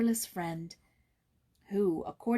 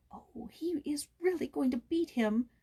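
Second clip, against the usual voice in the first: whispered, high with dread; girl hiding in the barn, whispering in fear; frightened whisper; 6 semitones higher than usual. A narrator's reading. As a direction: breathe it out in alarm. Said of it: "she thought, turning faint".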